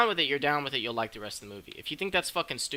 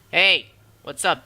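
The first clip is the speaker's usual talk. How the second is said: loud, higher, drawn-out mock call; imitated loud greeting; 5 semitones higher, 12 dB louder, slower than usual. Dog comes out of nowhere to the boy, loud mock greeting.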